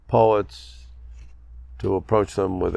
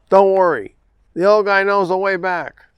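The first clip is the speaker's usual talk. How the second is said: high, raised holler of a trail guide; 9 semitones higher, 5 dB louder than usual. A narrator's reading. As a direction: call it out to someone down the trail. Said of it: "and hollered".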